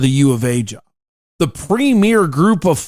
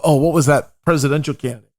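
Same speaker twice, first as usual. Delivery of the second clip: usual speaking voice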